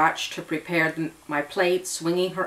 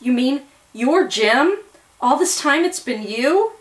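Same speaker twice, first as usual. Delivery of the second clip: stunned disbelief; 9 semitones higher, 7 dB louder than usual. A narrator's reading. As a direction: cry it out, unable to believe. Shocked discovery of identity.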